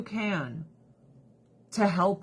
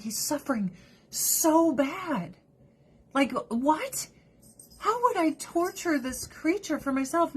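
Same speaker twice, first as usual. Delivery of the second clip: pained plea; 8 semitones higher than usual. Retold or imitated. Pleading to the vet, retold.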